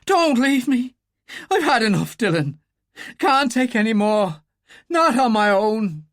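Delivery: high, loud frantic begging; panicked plea from the well; desperate pleading. A narrator's reading. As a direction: beg not to be left behind.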